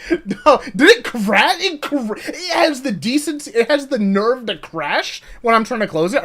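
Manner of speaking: high and loud, half laughing; outraged at the crashing game; incredulous rising rant; exasperated disbelief. Real, not acted.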